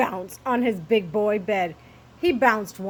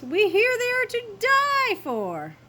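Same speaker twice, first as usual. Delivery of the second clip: very high, louder put-on voice; gushing little dogs; 13 semitones higher, 5 dB louder than usual. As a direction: rave about food as tiny dogs. Little dogs rave about peas.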